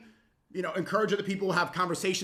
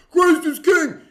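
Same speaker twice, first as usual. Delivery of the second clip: a screamed, much higher mock chant; mock yell; 11 semitones higher, 13 dB louder, slower than usual. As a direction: bellow it like a street heckler.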